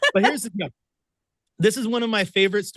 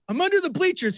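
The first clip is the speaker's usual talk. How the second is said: much higher, lightly voiced sheepish answer; sheepish reply; 12 semitones higher than usual. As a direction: answer meekly from a hiding spot, pitched up. Answering Jason from under bleachers.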